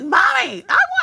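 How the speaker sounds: put-on mother copies child interrupting phone call; imitating a small child. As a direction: whine it like a little kid. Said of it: "She be like".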